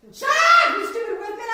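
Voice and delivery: high, loud shriek at her husband; furious yell. Retold or imitated. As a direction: scream it at him, fed up. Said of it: "I yell".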